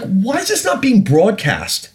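high, slow disbelief; incredulous rhetorical question. Retold or imitated. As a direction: ask it in mock outrage, up high. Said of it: "And I'm just like".

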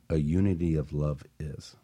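ordinary talk

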